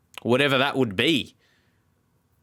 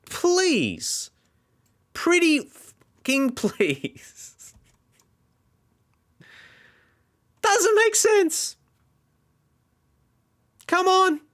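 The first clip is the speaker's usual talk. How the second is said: much higher, slow fed-up squeaky begging; exasperated pleading; much slower than usual. Real, not acted. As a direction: plead with the useless team in a strained high voice. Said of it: "Do you understand my frustration?".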